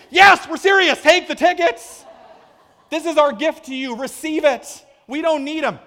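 high, loud, hammed-up urging; comically over-the-top generosity; shouted insistence. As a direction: push the gift on them at full voice. Acted insistence that mom take tickets.

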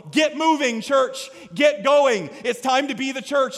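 preacher's forceful call to move; urgent exhortation. Acted. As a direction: urge the congregation into action. Preacher voices Jesus urging the church.

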